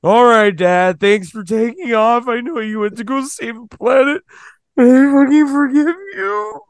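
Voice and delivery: high, sarcastic forgiveness. Retold or imitated. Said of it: "I'm sure you would say that to your dad".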